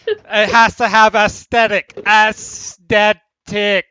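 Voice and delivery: loud, high, exasperated shout; demanding architect. Acted. Architect lecturing the blockheads on looks, shouting insistently. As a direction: drag out AESTHETIC loudly, syllable by syllable. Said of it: "gone back to directing the blockheads".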